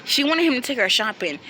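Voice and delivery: ordinary talk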